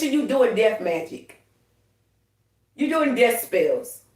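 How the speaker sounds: ordinary talk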